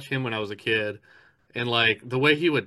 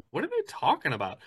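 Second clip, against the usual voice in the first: baffled exclaim; 9 semitones higher, 4 dB quieter, faster than usual. Retold or imitated. Confused quote of herself.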